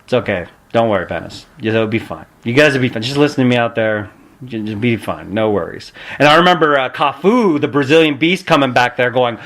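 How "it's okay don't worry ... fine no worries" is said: lower, softer reassurance; calm the nervous rookie down; calm big man soothing a nervous rookie; cool and calm. Retold or imitated. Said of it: "cool, calm, collected".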